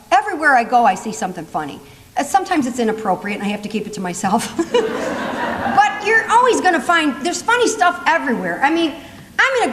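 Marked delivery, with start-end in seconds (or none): 4.5-5.6 breathy laugh at her own joke; a quick amused laugh. Real, not acted. Comedian laughing at her own line.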